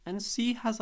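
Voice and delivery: ordinary talk, nothing marked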